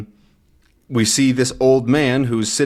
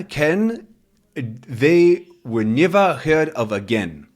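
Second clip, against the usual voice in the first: a light Scots take on "never heard of again", slow; Scottish accent; 4 semitones higher, slower than usual. Acted. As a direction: try the old man's Scots brogue. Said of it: "His accent's hard to do".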